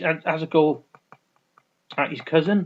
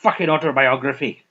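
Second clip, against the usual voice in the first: Irish assassin; 3 semitones higher, 7 dB louder than usual. Retold or imitated. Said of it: "the Irish assassin goes".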